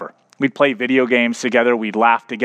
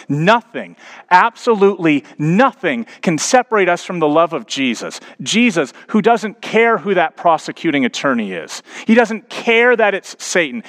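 higher and louder, rising sermon fervour; preaching at full force; 9 semitones higher, 3 dB louder than usual. Acted. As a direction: proclaim it from the pulpit. Preaching climax.

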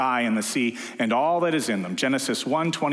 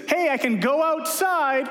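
put-on high, eager kid voice, much higher; a kid bursting out the door; excited kid; 14 semitones higher than usual. Kid shouting to parent about going outside, high and eager.

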